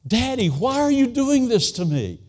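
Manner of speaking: put-on high, loud child's pleading cry; a toddler begging her daddy to stop; small daughter pleading. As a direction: plead like a frightened little girl. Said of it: "I could just hear her saying".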